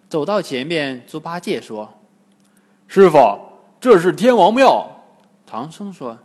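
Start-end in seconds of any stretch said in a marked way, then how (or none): 2.9-4.9 much louder call to master; loud announcing call. Acted. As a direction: shout the news ahead. Pigsy calls out to master.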